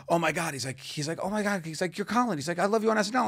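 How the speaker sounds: high, breathless star-struck gushing; gushing fan. Retold or imitated. Fan on the street gushing to Colin, star-struck. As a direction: squeal it like a fan meeting his idol.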